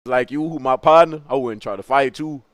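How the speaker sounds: ordinary delivery, nothing marked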